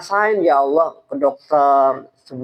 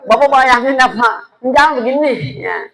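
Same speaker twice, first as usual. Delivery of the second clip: higher, louder nagging snap; hammed-up shrill telling-off; mock scolding wife; 8 semitones higher, 5 dB louder than usual. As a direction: play the nagging wife scolding her sick husband. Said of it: "digentak mulu sama bininya".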